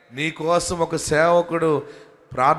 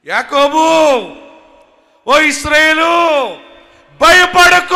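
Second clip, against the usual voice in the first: much higher, much louder, slow call; God's voice proclaimed; 11 semitones higher, 13 dB louder, slower than usual. Acted. Preacher voicing God calling to Israel, shouted high and loud. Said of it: "ఇదన్నీ దేవుడు చూశాడు".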